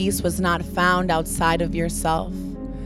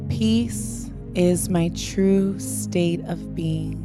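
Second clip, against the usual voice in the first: spoken affirmation; 3 dB quieter, much slower than usual. Shown as a demonstration. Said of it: "Repeating after me".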